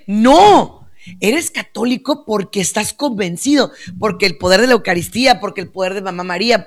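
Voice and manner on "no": loud, higher, drawn-out no; emphatic rejection. Real, not acted. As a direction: cut the excuse off forcefully. Rejecting the acted excuse.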